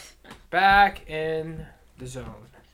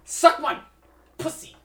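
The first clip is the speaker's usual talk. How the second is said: mock insult; 11 semitones higher, 7 dB louder than usual.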